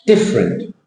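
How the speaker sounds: different in two beats, DIFF then small rent; different, squeezed short after DIFF; correct English stress. Shown as a demonstration. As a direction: hit DIFF in different and let it fall. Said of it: "Different shape altogether".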